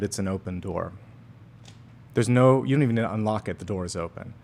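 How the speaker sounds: ordinary delivery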